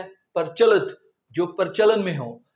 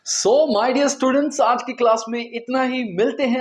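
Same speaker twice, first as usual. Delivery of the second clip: cheerful sign-off; 5 semitones higher, 5 dB louder than usual. Real, not acted. Closing the class.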